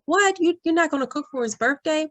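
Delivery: high-pitched, quick incredulous question; retold disbelief, pitched well up; mock surprise. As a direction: imitate someone taken aback, voice jumping up. Retelling someone's surprised reaction.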